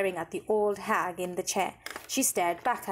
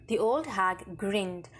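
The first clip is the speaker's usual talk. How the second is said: plain reading voice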